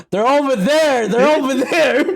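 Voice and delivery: hammed-up yell. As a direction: yell it like a frantic lookout.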